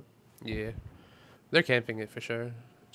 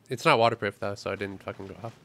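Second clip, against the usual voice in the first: ordinary delivery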